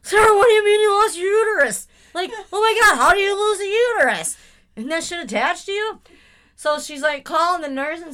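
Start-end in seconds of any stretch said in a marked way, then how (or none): 0.0-6.0 high loud mock disbelief; incredulous outburst. Host imagines yelling at her daughter, incredulous. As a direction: shout it in comic shock. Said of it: "And I'm like".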